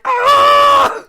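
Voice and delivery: very high, very loud cartoon scream; wolf's panicked scream. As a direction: scream as the wolf running for its life. Wolf flees under fire.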